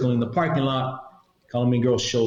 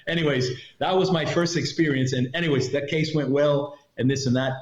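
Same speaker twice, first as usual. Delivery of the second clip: usual speaking voice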